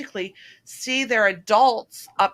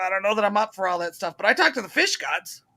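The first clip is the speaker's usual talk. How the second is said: lightly imitated Southern fisherman; a rough stab at his Southern accent. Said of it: "he's got a Southern accent".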